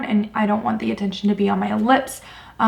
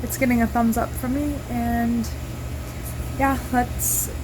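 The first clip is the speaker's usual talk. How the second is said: tinny, distant phone mic; recorded on a phone, far off; phone recording; 2 semitones higher, 7 dB quieter than usual. Real, not acted. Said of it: "Sorry for my phone quality".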